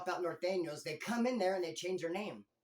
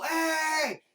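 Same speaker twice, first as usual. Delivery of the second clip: sudden high shouted "Hey!"; retold yell; 10 semitones higher, 9 dB louder than usual. Inmates below yelling up at the vent, loud shout. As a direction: bark it like the whole tier erupting. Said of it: "then you heard them all".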